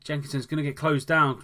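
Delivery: ordinary talk, nothing marked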